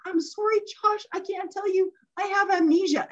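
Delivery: high-pitched, impish mock apology; playful giggly excuse. Mom teases nurse with fake amnesia, playful. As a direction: imitate a giggly fibber, voice lifted high. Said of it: "And she's just giggling".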